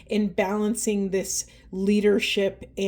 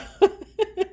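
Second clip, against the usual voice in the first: tearful laugh; 10 semitones higher than usual. Real, not acted. Laughing through tears mid-reading.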